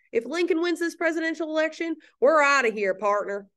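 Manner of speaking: high-pitched put-on Southern accent. Teacher voicing South Carolina threatening to leave, in a Southern accent. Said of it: "This is a good Southern accent".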